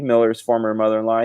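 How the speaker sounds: ordinary talk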